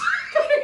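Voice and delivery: quick unvoiced burst of laughter; high breathless laugh. Real, not acted. Laughing with his cohost, breathless. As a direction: laugh at the absurdity.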